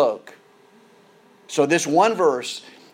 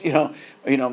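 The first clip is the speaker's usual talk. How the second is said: ordinary talk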